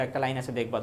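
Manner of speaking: usual speaking voice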